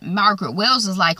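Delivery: ordinary talk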